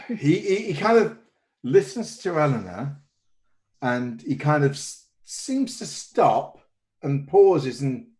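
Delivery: ordinary delivery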